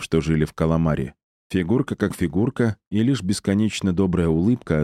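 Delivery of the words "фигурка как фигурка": usual speaking voice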